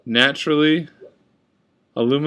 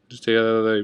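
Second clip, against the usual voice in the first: ordinary talk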